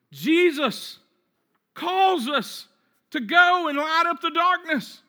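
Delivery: high, ringing pulpit declaration; full-force preaching. Real, not acted. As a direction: proclaim each phrase with force and space.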